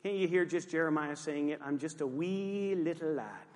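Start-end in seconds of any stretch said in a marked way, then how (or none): 1.6-3.5 slow, hammed-up Scottish lad; mock Scottish accent. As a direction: stretch "wee" in a playful Scottish brogue. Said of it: "You didn't know Jeremiah was Scottish".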